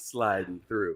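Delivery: lightly put-on catchphrase, dropped in pitch; infamous catchphrase. Host quoting a regular's infamous comment, voice dropped. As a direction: drop the voice for the signature line. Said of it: "his infamous".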